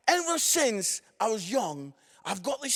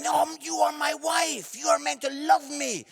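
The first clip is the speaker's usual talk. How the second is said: indignant protest; 6 semitones higher than usual. Retold or imitated. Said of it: "And I'm".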